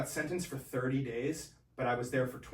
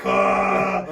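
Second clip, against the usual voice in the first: mock shocked yelp; 12 semitones lower, 15 dB louder than usual. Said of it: "I'm like".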